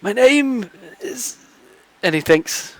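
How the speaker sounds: put-on high, soft, slow old man; hesitant old man thinking; frail old man voice. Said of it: "a frail voice".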